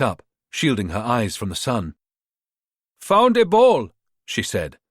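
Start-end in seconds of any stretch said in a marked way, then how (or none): 3.0-3.9 put-on bright, higher Ghanaian accent. Rita in disguise calling up to Natalia, Ghanaian accent. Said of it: "adopting the same Ghanaian accent".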